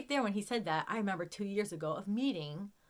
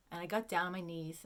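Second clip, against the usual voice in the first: ordinary delivery, nothing marked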